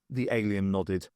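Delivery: ordinary delivery, nothing marked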